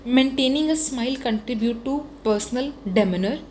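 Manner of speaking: slow, word by word, for notes; slow dictation. Shown as a demonstration. Teacher dictating a model exam line to students, slow and deliberate. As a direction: spell out the model line slowly so students can copy. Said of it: "एक ये line आप use कर सकते हो".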